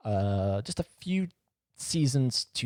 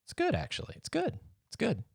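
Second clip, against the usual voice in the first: high sing-song; 14 semitones higher, 6 dB quieter than usual. Pretend. High sing-song after silence.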